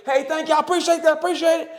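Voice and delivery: high, mock-weepy gushing thanks; imitated bawling. Man thanking strangers who stopped traffic for him, imitated bawling. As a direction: imitate yourself blubbering with gratitude. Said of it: "and I'm fucking bawling".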